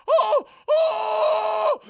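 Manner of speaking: very high shriek of fright; terrified scream. Retold or imitated. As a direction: shriek in terror. Terrified at giant.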